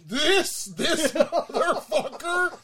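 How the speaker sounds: laughing while dreading the drink; half-laughing, slowed right down. Real, not acted. Dreading chugging a full drink.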